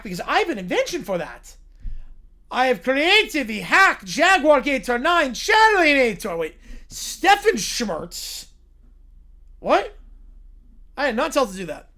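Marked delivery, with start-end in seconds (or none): 2.5-6.2 slow, louder villain voice; mad inventor boast. Acted. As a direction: announce the invention grandly. Villain announcing his invention.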